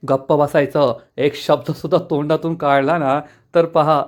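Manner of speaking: plain reading voice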